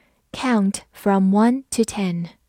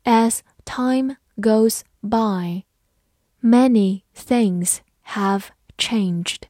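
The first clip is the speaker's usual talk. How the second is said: slow, word-by-word model reading; much slower than usual. Shown as a demonstration. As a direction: read it slowly, pausing after each word. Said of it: "好，慢读一遍". (慢读一遍，逐词停顿)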